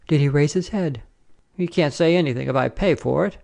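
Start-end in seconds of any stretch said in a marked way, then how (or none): none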